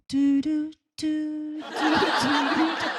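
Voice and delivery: spaced-out vocal sound effect; sung "du du du". Acted. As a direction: sing a little imitation tune on "du". Voices a soundtrack for exchanged glance. (嘴里哼唱“嘟嘟嘟”配乐)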